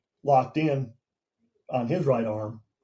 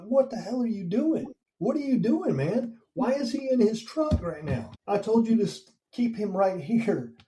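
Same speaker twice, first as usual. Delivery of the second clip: retold scream; 9 semitones higher than usual. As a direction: re-enact screaming at a trainee, voice pitched way up. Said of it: "I was screaming".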